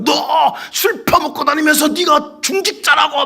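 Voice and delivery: high, loud accusing shout; demon's male voice. Retold or imitated. Possessed woman exposes an elder's sins, shouting accusations in demon voice. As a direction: rail slowly as the imitated demon. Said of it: "여잔데 남자 목소리로 막 해대는 거예요".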